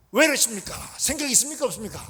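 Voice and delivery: loud, high, scolding outburst; indignant confrontation. Acted. Martha talking back to Jesus to get her way, indignant confrontation. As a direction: lash out with rapid-fire reproachful questions.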